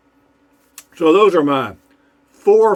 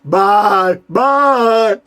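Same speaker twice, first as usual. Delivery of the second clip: a loud bye-bye, pitched higher, mocking her back; husband finally mocks his wife's farewell; defiant bye-bye; 7 semitones higher, 7 dB louder than usual. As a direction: throw her own bye-bye right back at her. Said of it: "he looked straight at her".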